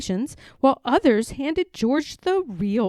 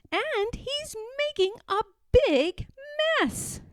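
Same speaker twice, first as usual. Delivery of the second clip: startled nurse; 13 semitones higher, 3 dB quieter than usual. Acted. Nurse sees monkey mess.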